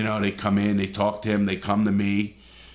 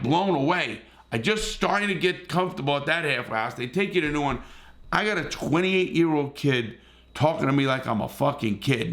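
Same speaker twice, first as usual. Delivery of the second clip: usual speaking voice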